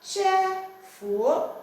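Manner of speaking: CHEFUO slowly in parts, high, with a rising end; Igbo tone demonstration. Shown as a demonstration. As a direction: hold CHE high and loud, then lift the end of FUO. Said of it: "the tones must be correct".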